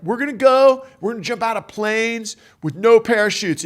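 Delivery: imitated loud, high battle orders; rally the team for a daredevil jump; rallying command. Imagined rallying order from Herbie.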